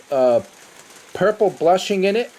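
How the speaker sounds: ordinary talk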